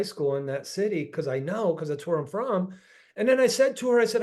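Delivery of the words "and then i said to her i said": usual speaking voice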